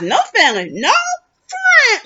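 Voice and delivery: "friend" jumps way up in pitch at the end; mock them, hitting "friend" with a high squeal; stress on friend. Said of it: "emphasize the word friends".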